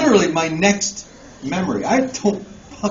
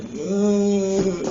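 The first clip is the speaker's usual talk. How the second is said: put-on a drunken wordless groan, higher pitched; drunk guy; 5 semitones higher than usual. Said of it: "that guy who's like this".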